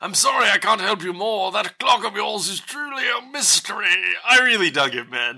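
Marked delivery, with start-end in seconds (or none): 0.0-4.2 put-on genteel old Englishman; genteel old British man. Old expert apologising to Lara about her clock, genteel British voice. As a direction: play the courteous elderly British expert. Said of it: "like, very genteel old British man".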